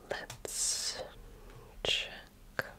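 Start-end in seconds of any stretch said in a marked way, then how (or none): none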